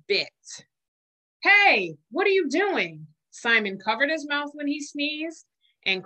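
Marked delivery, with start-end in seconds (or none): 1.4-3.1 higher, louder cry of alarm; a germ protesting in shock. Acted. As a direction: shout at Simon as he scrubs. Germs alarmed at Simon.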